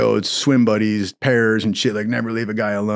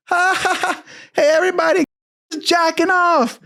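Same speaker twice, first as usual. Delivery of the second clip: very high mocking holler; cackle, then crow it out high; gleeful taunting announcement. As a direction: imitate a bunkmate announcing it to the whole room. Bunkmate loudly exposing him, taunting.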